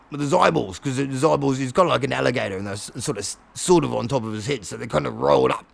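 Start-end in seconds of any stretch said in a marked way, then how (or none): none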